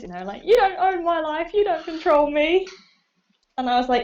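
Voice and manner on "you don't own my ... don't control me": higher mock teen defiance; rebellious teenager. Actor playing a rebellious teen, mocked as overacted. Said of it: "like teenagers when they go through that rebellious phase".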